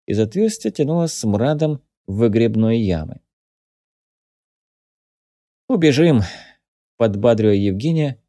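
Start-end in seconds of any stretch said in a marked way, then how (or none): 5.7-6.2 firm, raised, louder exclamation; confident encouragement. A narrator's reading. Captive cheers on his injured companion, confident. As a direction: say it with conviction to hearten him. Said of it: "убеждённо сказал Павел".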